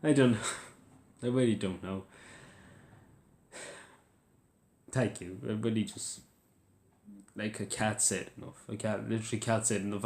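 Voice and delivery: halting, tearful and lost for words; overcome, sinking into soft, grateful words; crying. Real, not acted. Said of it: "I'm literally crying".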